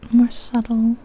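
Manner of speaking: usual speaking voice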